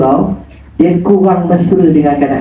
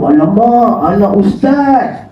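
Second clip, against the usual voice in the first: high, drawn-out oops; old man realizing his mistake, dismayed; dismayed surprise; 5 semitones higher, slower than usual. Retold or imitated. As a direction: caught out, slow and higher. Old man realizes it's ustaz's child.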